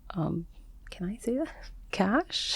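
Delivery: soft, breathy question under the breath; real hushed check before saying something delicate; whispered aside. Real, not acted. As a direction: whisper it as if it's a secret. Asks if she may mention cash.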